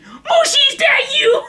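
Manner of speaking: high-pitched mimicked holler; crying out. Man cries out at every bus stop, high shouted call. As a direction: imitate a man wailing out a name. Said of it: "he's crying for Mushi".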